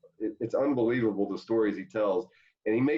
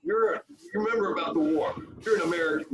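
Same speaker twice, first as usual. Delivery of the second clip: higher, rousing pep-talk voice; Tommy Lasorda impression; 8 semitones higher than usual. Lasorda pumping up players at lunch, higher rousing pep talk. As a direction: fire the team up like old Tommy. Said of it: "he's like".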